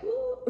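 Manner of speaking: a sharp high woo like a boiling kettle; high kettle whistle. Retold or imitated. Reader mimicking a kettle heard in the reading, high woo. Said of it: "I'm hearing, like, a whistle".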